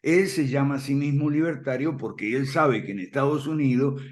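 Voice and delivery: normal talking voice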